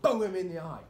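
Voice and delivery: yelled with a high pitch; loud excited shout. Real, not acted. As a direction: shout it out gleefully. Excited violent joke.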